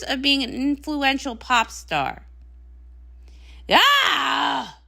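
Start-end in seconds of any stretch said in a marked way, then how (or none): 3.7-4.8 short angry shout of frustration; fed-up wordless outburst; frustrated yell. Acted. Frustrated outburst after argument.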